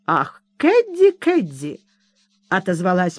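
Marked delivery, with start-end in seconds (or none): 0.1-1.8 high, slow, drawn-out indulgent Ах; a mildly voiced placid mother; benevolent sigh. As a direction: gently chide the girl with good humour. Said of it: "с величайшим благодушием".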